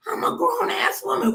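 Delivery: very high-pitched mock indignation; indignant comeback. Star answering bosses who police her talk, mock indignant. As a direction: squeak out an affronted retort.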